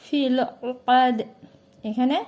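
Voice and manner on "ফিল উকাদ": very slow recitation, drawn-out vowels. Shown as a demonstration. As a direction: chant it slowly and evenly. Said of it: "তাহলে". (ধীর তিলাওয়াত)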